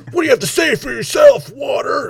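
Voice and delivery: high, loud shouted question at a prop; mock shouted demand. Comedian screaming at his water bottle, mock shout. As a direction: scream the question like a furious general. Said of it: "yelling at his water bottle".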